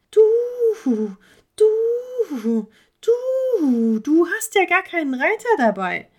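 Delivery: a hooting bird voice, high and drawn out at the end; stuttering owl. Acted. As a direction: play a silly owl that stutters its du, du, du. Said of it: "überlegte der Uhu".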